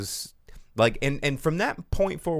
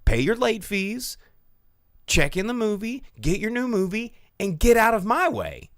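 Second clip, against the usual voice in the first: higher, louder scolding of the rude customer; retold scolding; 10 semitones higher, 5 dB louder than usual. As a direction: tell her off, building up. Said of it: "And I was like".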